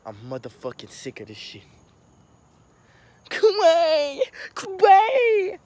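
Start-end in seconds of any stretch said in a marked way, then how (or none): 3.3-5.6 mock wailing. Mock wailing for departing star.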